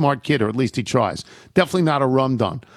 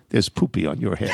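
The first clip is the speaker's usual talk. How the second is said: unbelievably sweet; 5 semitones lower than usual. Retold or imitated. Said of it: "unbelievably sweetly".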